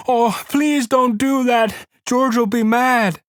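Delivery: Lenny pleads in fright, afraid George will be mad; frightened begging. A narrator's reading. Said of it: "Lenny began to cry with fright".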